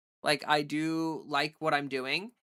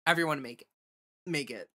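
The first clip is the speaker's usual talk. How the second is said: hiccups; faster than usual. Real, not acted. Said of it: "Oh no, I got the hiccups".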